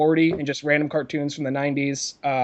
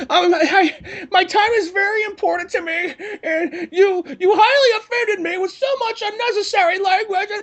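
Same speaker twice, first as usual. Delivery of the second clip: high, laughing whiny voice of an offended fan; mock angry fan. Mimicking an offended Newgrounds fan, high and whiny. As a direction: send up an outraged online commenter, much higher and laughing through it. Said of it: "a standard angry Newgrounds fan".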